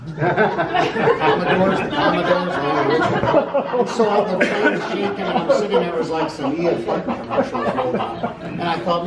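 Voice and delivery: loud, high, laughing through the words; laughing hard. Real, not acted. Telling the group the plane story, laughing loudly. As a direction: crack up at your own punchline. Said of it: "that was funny to me".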